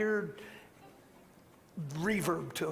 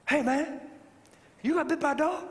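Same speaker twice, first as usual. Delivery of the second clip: higher-pitched Southern man impression; imitated Southern accent; 8 semitones higher than usual. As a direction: imitate an excitable Southern fella. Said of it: "He's real Southern".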